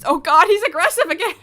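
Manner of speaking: loud, high half-laughing alarm; mock-terrified giggle; laughing panic. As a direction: laugh while crying out in mock fear.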